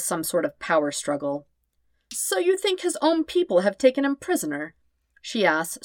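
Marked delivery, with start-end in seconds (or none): 2.1-4.7 high, doubtful question; not quite buying it; slightly disbelieving. Acted. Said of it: "slightly disbelieving".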